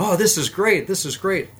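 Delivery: delighted. Retold or imitated. German backseater enjoying the controls, excited. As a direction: play him thrilled.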